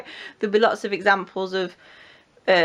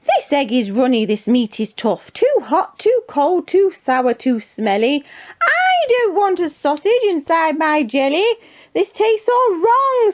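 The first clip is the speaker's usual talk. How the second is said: complaints rising into high, drawn-out protest; fussy hungry king; 9 semitones higher, 6 dB louder, slower than usual. Acted. Hungry king rejecting the cooks' food, fussy complaining. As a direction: build to an exaggerated royal complaint.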